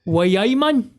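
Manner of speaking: high, shouted Geordie catchphrase; Geordie accent. Acted. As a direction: call it out in broad Newcastle dialect.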